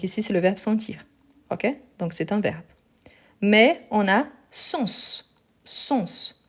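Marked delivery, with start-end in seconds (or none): 3.4-5.2 slow "sens" with the final S held; slow demo. Shown as a demonstration. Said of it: "on prononce le S". 5.6-6.4 "Sens" whispered with a clear final S; whispered demo. Shown as a demonstration. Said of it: "on prononce le S".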